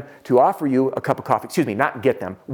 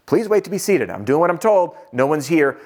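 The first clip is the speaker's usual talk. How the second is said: higher-pitched retold exasperation; higher, indignant inner grumble; frustrated; 3 semitones higher than usual. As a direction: act out mounting impatience at being ignored. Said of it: "I am frustrated at this point".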